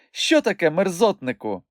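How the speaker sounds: high-pitched, challenging woman; snippy comeback, pitched well up; indignant retort. A narrator's reading. Trading insults in playful quarrel.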